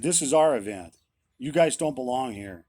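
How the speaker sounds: loud, retold angry rebuke; pissed off pastor. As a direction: throw it at them like an angry pastor. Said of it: "he was pissed off".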